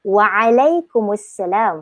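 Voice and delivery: Waalaikumsalam slow, long WAA and drawn "alai"; Arabic reply model. Shown as a demonstration. Teacher demonstrating the reply to learners, Waalaikumsalam slow and elongated. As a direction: stretch Waalaikumsalam carefully, clear throat ain. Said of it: "Cara jawapan dia".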